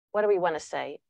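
ordinary talk

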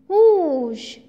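a big loud gust of wind; loud whoosh. A narrator's reading. T-shirt blown down by wind.

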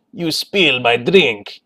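slow, hammed-up bad Russian accent; villain threat in a mock Russian accent; terrible Russian accent. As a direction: drawl it out in a cartoon Russian accent. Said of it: "sorry for the terrible Russian accent".

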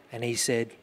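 normal talking voice

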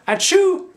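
a high, loud mock sneeze; calf sneeze. The calf sneezes at the hay, a high loud mock achoo. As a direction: sneeze big like a tickled calf.

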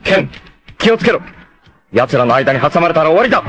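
high, fast shouted warning; urgent warning. Acted. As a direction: yell the danger to Ken. Warning Ken mid-battle. (ケンへの緊迫した警告)

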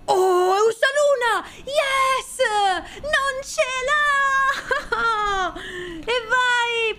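high laughing shouts of victory; triumphant. Real, not acted. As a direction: cheer like you just won. Guessing game won, Ursaluna.

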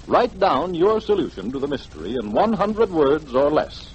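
ordinary delivery

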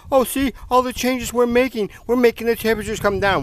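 high, prissy mocking voice; mocking smug officials. Vlogger mocks officials claiming credit for cooling, high smug parody. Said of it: "they're gonna keep fighting and say".

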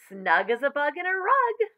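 high, loud, climbing lilt; rising acted glee; gleeful sing-song. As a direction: chirp it with playful satisfaction. Mother gleeful about hidden worm.

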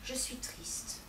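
whispered sulking complaint; wife sulking in a whisper to her husband; sulky whisper. Acted. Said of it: "elle dit encore".

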